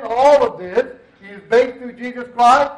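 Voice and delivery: usual speaking voice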